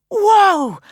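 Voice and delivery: loud astonished whoa; amazed. A narrator's reading. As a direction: gasp it out in wonder. Peregrine sees magic.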